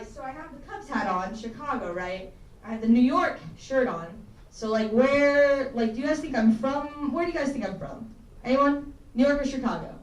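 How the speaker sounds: ordinary talk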